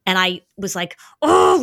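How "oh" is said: exasperated oh. Retold or imitated. Mom's frustration over flat tire.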